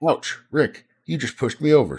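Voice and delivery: mildly hammed-up "ouch" at being bumped; startled complaint. A narrator's reading. Tony to Rick after being pushed over, startled complaint. Character knocked over, cries out.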